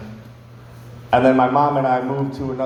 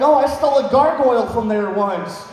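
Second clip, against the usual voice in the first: higher, girlish mock confession; imitating the girl; 8 semitones higher than usual. Imitating the gal admitting the theft, high girlish voice. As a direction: play her as breezy and clueless. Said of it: "she's like".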